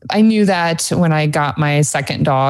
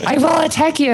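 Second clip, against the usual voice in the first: high, playful mock threat; hammed-up menacing dog voice; mock threatening dog; 11 semitones higher than usual. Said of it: "it's like".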